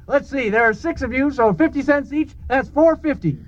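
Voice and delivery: ordinary talk, nothing marked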